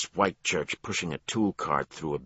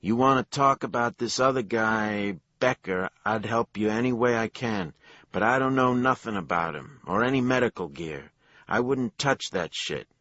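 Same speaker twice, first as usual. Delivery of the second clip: put-on nervous Jersey accent, higher and louder; 8 semitones higher, 6 dB louder than usual. A narrator's reading. Worker denying to detectives, higher louder Jersey accent. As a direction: keep the Jersey accent, insisting he knows nothing. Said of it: "his Jersey accent as thick as mayonnaise".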